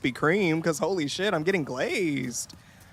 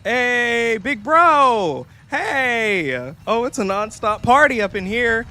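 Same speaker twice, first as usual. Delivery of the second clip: higher, brighter hello to big bro; excited greeting; 8 semitones higher, 5 dB louder, slower than usual. Real, not acted. Streamer greets her big bro as he joins, excited. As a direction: greet him like the party just started.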